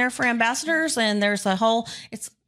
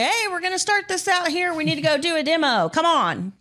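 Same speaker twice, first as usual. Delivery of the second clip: higher-pitched mock rally, urging; rallying call; 7 semitones higher than usual. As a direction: round up the players like an eager organiser. Retold Pop rallying players.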